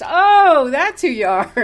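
high, loud oh of recognition; surprised recognition. Retold or imitated. The woman recognises the teller at the clubhouse, high and surprised. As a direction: jump up on the oh as it clicks.

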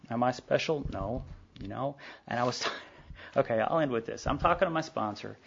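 ordinary talk, nothing marked

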